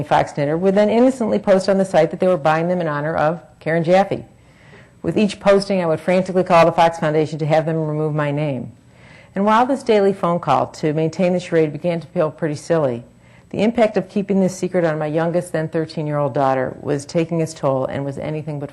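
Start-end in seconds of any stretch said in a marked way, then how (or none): none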